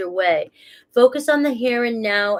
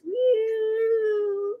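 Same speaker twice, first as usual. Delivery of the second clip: playful whoop; 12 semitones higher than usual. Pretend. Joking about being in space.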